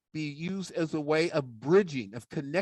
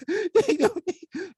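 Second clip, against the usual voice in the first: high, slow laughing puppet voice; much slower than usual. Acted. Puppet sidekick laughing at being told to leave, high and slow. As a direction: laugh it out, much higher.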